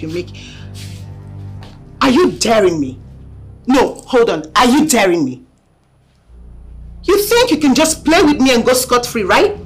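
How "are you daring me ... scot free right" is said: loud, high enraged threats; furious shouting. Acted. Angry woman threatening on phone.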